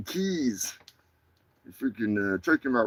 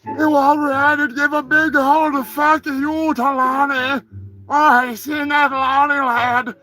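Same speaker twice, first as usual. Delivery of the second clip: high, put-on vague Scottish-ish accent; mock accent calling out a friend; mystery accent; 9 semitones higher than usual. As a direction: ham up a wee-lad Scottish accent. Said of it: "I don't know what that accent is".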